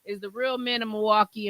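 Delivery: ordinary delivery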